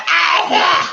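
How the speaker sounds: loud, high, unrestrained sneeze; full sneeze. Retold or imitated. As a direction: let the sneeze go freely. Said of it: "if I'm allowed to just free ball it".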